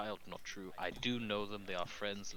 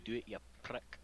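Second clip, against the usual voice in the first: put-on Scottish accent. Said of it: "To emulate your Scottish accent".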